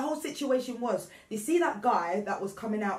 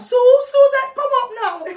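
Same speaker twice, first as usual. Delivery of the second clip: mum calling out; 14 dB louder than usual. Retold or imitated. Mum calling her child in from play, loud imitated holler. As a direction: yell it up the street as an impression of mum. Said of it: "my mum used to call me up".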